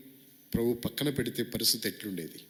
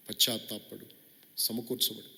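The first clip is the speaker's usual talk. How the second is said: ordinary talk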